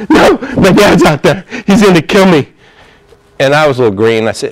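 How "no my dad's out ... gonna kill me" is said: high, loud mock terror; acted panic, pitched way up; imitated terrified kid. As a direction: play a frightened boy refusing to budge. Retold terrified kid refusing to move.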